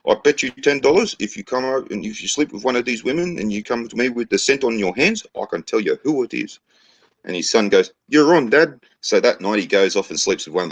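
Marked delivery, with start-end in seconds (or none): 0.1-6.6 put-on high-pitched Maori chief in a New Zealand accent; joke-voice New Zealand accent, raised pitch. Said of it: "New Zealand accent there". 8.1-8.8 high-pitched son voice; acted eager kid accepting a challenge; cocky son. Son takes bet in joke.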